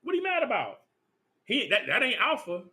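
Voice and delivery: hammed-up voice of the culture mocking the king; mock outrage. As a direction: play the offended modern critic. Mocking modern critics of the king.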